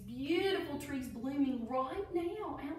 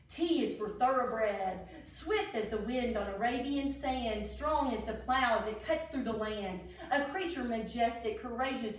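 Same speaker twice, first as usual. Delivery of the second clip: lilting rhyming storybook read; reading to children; 4 dB louder than usual. Acted. Teacher reading a Derby alphabet book to children, storybook voice. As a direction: read each verse like a picture book for kids.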